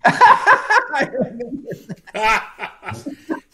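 loud, high burst of laughter; real laughter. Real, not acted. Listener reacting to the singer's punchline, laughing loudly. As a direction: laugh long and hard at a joke.

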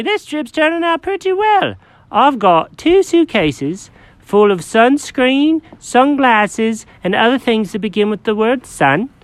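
high, excited mock-British cat. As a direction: chirp it like a proper Brit. Jenkins British-ish high.

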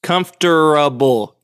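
"comfortable" slowly, syllable by syllable; as spelled. Shown as a demonstration. Insisting on the spelling, slow spelled-out pronunciation. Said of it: "It is spelled".

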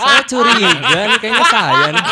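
laughing as he accuses himself; laughing suspicion. Real, not acted. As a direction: joke that he's the one. Suspecting he's the target.

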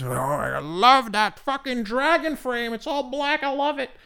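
high, slow, breathy-free swooning gush; mock gushing customer. Customer mocked for falling for frames, in a high gushing voice. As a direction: play a dazzled shopper gushing.

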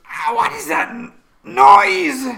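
high, loud screamed complaint; pained outcry at noise. Acted. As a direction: cry out over a deafening sound. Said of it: "and everybody, like, goes".

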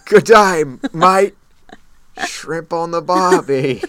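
high, loud, hammed-up Australian accent; mock Australian accent. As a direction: lay the Aussie clichés on thick. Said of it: "I can't do it".

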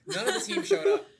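says it again through real laughter, pitch jumping way up; laughing. Real, not acted. Retelling teammates not showing up.

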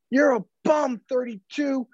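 put-on high, jeering old man; old heckler. Old fan heckling Jay Crowder, high mocking jeer. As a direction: sneer it like a cranky old fan. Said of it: "he's just like".

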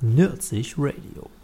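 slow, low, soft bass voice; extremely deep bass voice. Shown as a demonstration. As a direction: drop it to the bottom of your range. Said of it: "ich mach die tiefe Stimme".

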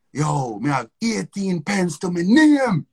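slow, drawn-out Jamaican patois. Retold or imitated. Yardie asks for money in slow Jamaican patois. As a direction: stretch out a Jamaican patois line. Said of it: "Exactly how he said it".

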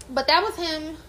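ordinary delivery

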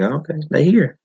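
breezy "Okay, they here," like it's nothing; nonchalant. Retold or imitated. Mocking the remake's girl again, nonchalant shrug. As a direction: toss it off, unbothered. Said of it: "Like, all nonchalant".

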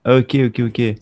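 usual speaking voice

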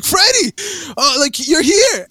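high-pitched, delighted surprise at spotting someone; acted-out excited recognition; excited greeting. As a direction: reenact an amazed hello, voice jumping up. Retold greeting of Freddie in dream.

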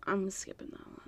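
soft unvoiced whisper. Real, not acted. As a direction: whisper it to yourself. Whispering aside while choosing songs.

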